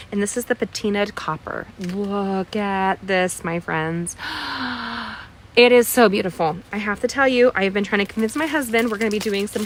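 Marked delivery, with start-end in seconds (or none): 4.2-5.2 soft, drawn-in gasp of delight; awed gasp. Real, not acted. Crafter shows off gorgeous copper paper, awed gasp. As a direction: gasp in admiration.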